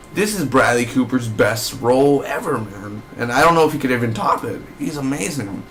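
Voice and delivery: normal talking voice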